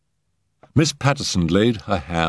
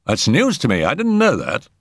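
high, startled man's voice; Settle, surprised; 6 semitones higher than usual. Acted. Settle reacting to the footman's news, high and surprised. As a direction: pitch it up in surprise.

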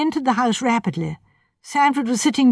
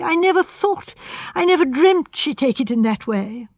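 put-on a lightly higher, anguished young man; fretful, near breaking, blaming himself; distressed young architect; 6 semitones higher than usual. A narrator's reading. Young architect upset before the police, lightly higher and anxious.

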